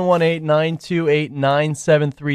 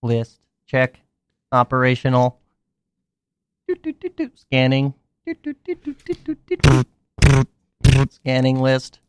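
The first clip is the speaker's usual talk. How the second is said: beeping robot scanning; 3 semitones lower than usual. Pretend. Pretends to be a robot.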